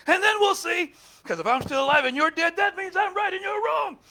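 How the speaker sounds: high and loud, triumphant; loud smug boast. Retold or imitated. Pastor replaying his old revenge logic, loud and smug. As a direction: crow it over your enemies.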